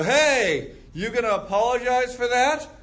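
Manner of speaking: hammed-up indignant demand; mock confrontation. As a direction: call them out like you're offended. Acting out confronting a bumper.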